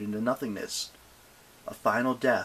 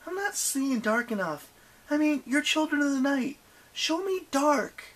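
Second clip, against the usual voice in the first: put-on taunting girl. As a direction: dare them, higher. Annabeth taunting.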